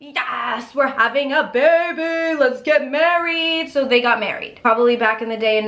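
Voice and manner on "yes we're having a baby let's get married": put-on gleeful cheering about the baby; overjoyed husband, cheering yes; excited. Husband cheering to wife about the pregnancy, excited. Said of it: "and he was like".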